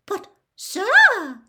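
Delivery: high, loud squeaky elf joy; excited house-elf. Acted. Dobby voice.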